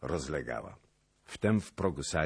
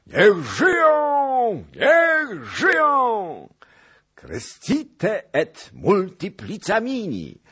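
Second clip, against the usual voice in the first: very high, slow, stretched crowd shout; roaring cheer; 10 dB louder, much slower than usual. A narrator's reading. Knights answering the toast with a roaring cheer. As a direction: bellow it like a tipsy hall of knights.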